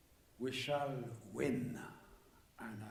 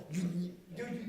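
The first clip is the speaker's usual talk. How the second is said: ordinary talk, nothing marked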